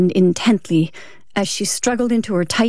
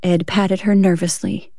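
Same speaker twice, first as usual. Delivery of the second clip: usual speaking voice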